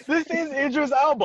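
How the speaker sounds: high, put-on British accent; Idris Elba. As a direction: insist on it in a mock British voice. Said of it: "They gonna expect that British accent".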